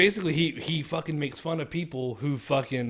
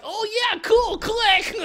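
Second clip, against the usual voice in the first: put-on much higher squeaky kid voice, loud; mock excited kid; 6 dB louder, slower than usual. Mocking kids who click on everything, high childish voice. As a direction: imitate a dumb eager kid clicking. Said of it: "the kids are like".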